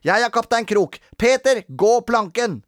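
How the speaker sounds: high, loud play-command; boisterous pretend captain; child playing pirate. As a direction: order a prisoner about in a game. Robin plays pirate captain.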